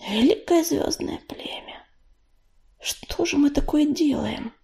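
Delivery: soft, airy anxious thought; hushed inner plea. A narrator's reading. Белочка silently appeals to StarClan after a shiver, hushed. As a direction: think it aloud, barely voiced.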